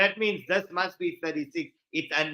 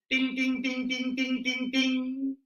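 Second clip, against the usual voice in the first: rapid monotone dings, higher in pitch; imitated bell dings; 4 semitones higher, 5 dB quieter, slower than usual. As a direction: chime like a correct-answer bell. Imitates a bell at correct answer.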